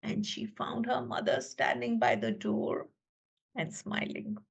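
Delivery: fading into a hushed whisper; softly. A narrator's reading. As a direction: let it drift off quietly. Calm ending, fading to a whisper.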